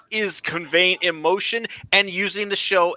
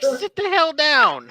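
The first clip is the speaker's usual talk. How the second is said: higher, slow, drawn-out mock order; mock scolding; 7 semitones higher, slower than usual. Onlooker staring down a show-off, mock scolding. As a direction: imitate a fed-up onlooker putting someone in their place.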